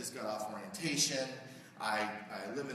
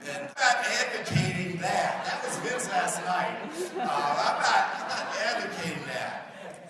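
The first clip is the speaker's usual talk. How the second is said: laughing; 6 semitones higher, 10 dB louder, slower than usual. Real, not acted. Laughing disclaimer after video clip.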